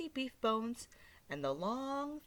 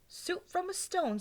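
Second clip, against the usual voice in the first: put-on a touch of old woman wonder; old lady voice; 9 semitones higher than usual.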